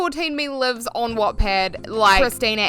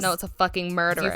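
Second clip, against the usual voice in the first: ordinary talk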